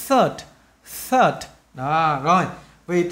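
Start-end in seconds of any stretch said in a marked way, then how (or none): 0.1-1.5 "Third" with the tongue between the teeth and a released final D. Shown as a demonstration. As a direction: start "Third" on a voiceless TH, tongue out. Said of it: "âm đờ cuối cùng phải bung ra". (đưa lưỡi ra giữa hai hàm răng khi nói "Third")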